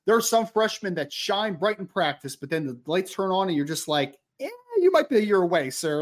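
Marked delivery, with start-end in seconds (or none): 4.4-6.0 high, drawn-in mock hesitation breaking the news; mock-polite letdown, pitched up; doubtful verdict. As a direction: hedge it in a higher, teasing voice. Imagined verdict on a freshman.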